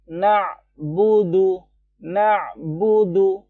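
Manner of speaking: na'budu dengan bunyi s; na'budu slowly, sound stops dead; na'budu cut short. Shown as a demonstration. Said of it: "Jadi beda sama yang na'budu". (na'budu dibaca pelan, bunyinya langsung mati)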